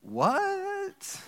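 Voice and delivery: high, startled what?; baffled what. Retold or imitated. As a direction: squeak it out in disbelief. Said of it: "And Joshua's like".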